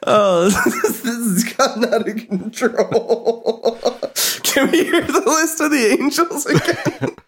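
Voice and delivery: high, tearful laughing speech; helpless laughing. Real, not acted. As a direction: squeeze the words out through real laughter. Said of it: "I'm laughing so hard that tears are coming".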